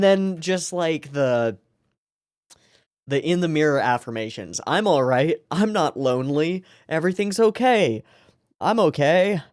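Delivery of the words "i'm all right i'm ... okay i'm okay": higher, lightly voiced boy forcing himself to say he's fine; a hint of a trembling kid lying to himself; shaky self-reassurance. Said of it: "he says, shaking in bed".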